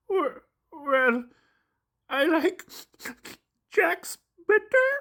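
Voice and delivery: high, slow monster voice, unsure; high puppet voice, drawn out and unsure; hesitant Grover. Acted. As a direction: hold back, then admit what you like.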